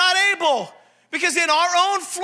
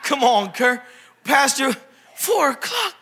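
mock congregation groan; 3 semitones lower, much slower than usual. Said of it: "I just saw faces like".

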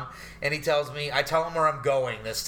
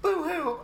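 squeaky high mock crying "Boo-hoo". Mocking his own sob story with a fake wail. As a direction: whine it like fake tears.

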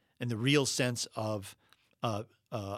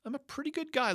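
much higher, self-satisfied repeat of the line; mock-proud sing-song pitch on "I'm a pretty good guy"; smug inner voice, pitched way up; 14 semitones higher, faster than usual. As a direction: jump up high to mock the self-praise. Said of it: "the interior narrative is".

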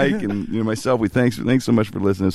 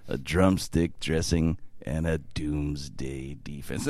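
much lower, softer, very slow and dramatic; Facenda impression; 9 semitones lower, 9 dB quieter, much slower than usual. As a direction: boom it out like a solemn film narrator. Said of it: "it was so dramatic".

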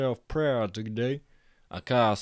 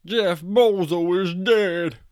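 much higher, louder, slow proclamation; announcing loud; 7 semitones higher, 7 dB louder, slower than usual. A narrator's reading. Jack announcing the death to the party, loud. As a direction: proclaim it to the crowd.

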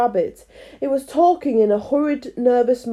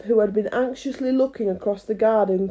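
ordinary delivery

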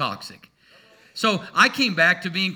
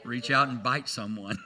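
ordinary delivery

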